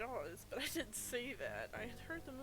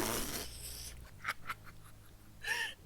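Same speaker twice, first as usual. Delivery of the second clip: loud laugh; 6 dB louder than usual. Real, not acted. Shocked at vampire age question.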